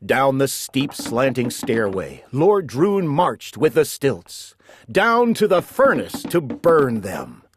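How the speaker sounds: ordinary delivery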